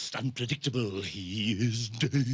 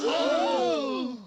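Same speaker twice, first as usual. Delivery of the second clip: sudden high startled cry; startled shriek; 6 dB louder than usual. Acted. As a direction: jump out of your skin with a yelp.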